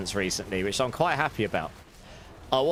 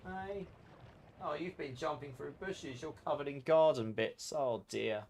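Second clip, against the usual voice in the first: much softer, gentle pet talk; soft cooing to a pet; 2 semitones higher, 10 dB quieter than usual. Real, not acted. To her cat covered in garden bits, soft cooing.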